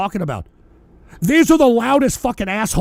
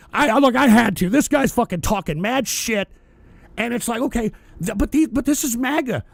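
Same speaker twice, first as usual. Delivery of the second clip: normal talking voice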